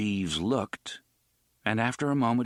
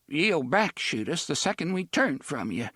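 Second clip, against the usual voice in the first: trembling voice; 6 semitones higher than usual. A narrator's reading. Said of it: "said in a trembling voice".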